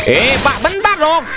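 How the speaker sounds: high pitched wait wait; flustered squeal; urgent high plea. Real, not acted. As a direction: stop the game quickly. Asking to pause.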